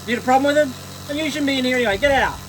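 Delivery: high put-on voice, defensive; hammed-up prudish bather; mock indignant. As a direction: shoo the viewer out in character. Mock telling off viewer.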